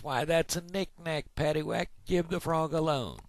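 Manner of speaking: high-pitched bank manager delivering the punchline; excited bank manager. Acted. Bank manager explains the collateral to Paddywhack, high and emphatic. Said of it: "the bank manager took one look at it".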